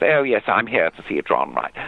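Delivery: lightly put-on RP toff; mock posh voice. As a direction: mimic her grand RP without meaning to. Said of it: "I put on this posh voice, sort of mimicking her".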